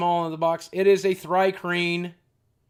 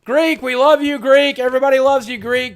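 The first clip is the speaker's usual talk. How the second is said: loud, higher rallying call; excited cheer; 6 semitones higher, 6 dB louder than usual. Real, not acted. Streamer loudly rallying chat to love Greek.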